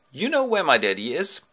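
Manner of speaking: high rising lad's voice; boyish question. Acted. Telemachos asks Menelaus about Daddy, high boyish voice. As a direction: ask like a hopeful little boy. Son repeats his question, acted.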